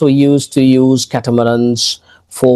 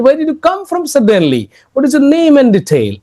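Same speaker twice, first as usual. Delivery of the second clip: teacher's question; 9 semitones higher, faster than usual. Acted. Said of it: "the teacher got to see her and said".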